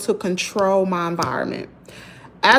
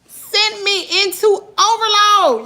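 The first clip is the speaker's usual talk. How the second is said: exasperated; 10 semitones higher, 4 dB louder, slower than usual. Real, not acted. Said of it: "that just sent me into overload".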